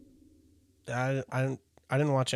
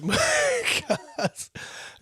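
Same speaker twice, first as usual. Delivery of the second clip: high laugh. Real, not acted. Laughing at the joke.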